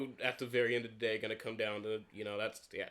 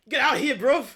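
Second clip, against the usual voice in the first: high, loud, jokey British bruv line; mock British; 10 semitones higher, 7 dB louder than usual. Riffing on the summon, mock British. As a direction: call it out in a cartoon British accent. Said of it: "too much British".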